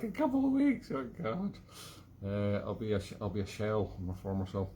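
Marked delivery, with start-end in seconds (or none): 0.0-1.5 high, shocked disbelief; mock-appalled shriek at the wait; incredulous. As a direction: squeak it out in horror. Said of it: "I was like".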